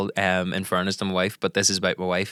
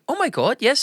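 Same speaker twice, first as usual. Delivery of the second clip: higher Belfast Filipino twang, lightly voiced; playful higher Belfast voice of a young server; Belfast Filipino girl. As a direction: imitate the KFC girl's Belfast lilt, pitched up. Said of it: "And she was like".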